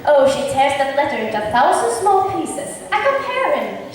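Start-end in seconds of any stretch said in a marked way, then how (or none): 2.9-3.9 lightly raised quoting voice; Beatrice's quoted words. Retold or imitated. Hero quoting Beatrice to the men, lightly voiced. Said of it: "she says".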